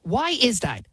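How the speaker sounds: usual speaking voice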